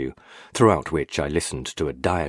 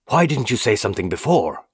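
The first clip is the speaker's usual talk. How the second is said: sharp angry question, higher and louder; snapping; 6 semitones higher, 5 dB louder than usual. A narrator's reading. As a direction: snaps at him in irritation. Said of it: "Burney snapped".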